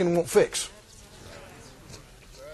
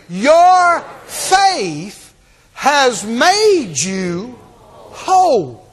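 "Your faith has made you whole" with "Your" raised and "made" stretched very slow; preacher's climactic take, drawn out and rising; slow drawn-out proclamation; much slower than usual. Shown as a demonstration. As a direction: proclaim it slowly, leaning on each word. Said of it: "Say it again".

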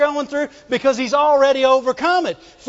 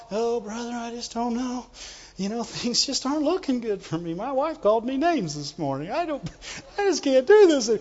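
mock defeated grumbler, much softer; discouraged complaint; 8 dB quieter than usual. Preacher mimicking people who don't talk victory, weary defeated complaint. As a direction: moan it half-heartedly, imitating a down-in-the-mouth believer. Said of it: "They don't talk".

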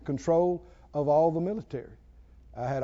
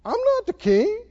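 high whiny mock protest; a touch of whining servant; whiny staff; 12 semitones higher than usual. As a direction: squeak it like a timid helper. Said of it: "looked at me and said".

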